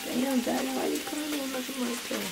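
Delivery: soft seductive come-on; breathy flirty tease; sultry low flirt. Acted. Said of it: "I don't like that".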